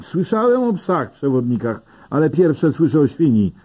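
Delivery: wyższy, urzędowy ton gliniarza; grzecznie, ale stanowczo, jak policjant z przepisami; lekko zagrany policjant. A narrator's reading. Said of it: "mówi gliniarz". (policjant poucza Dana, lekko wyższym głosem)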